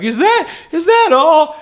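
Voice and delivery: high slow amazed worker; high incredulous. Retold or imitated. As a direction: ask in disbelief, pitch way up. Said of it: "they're like".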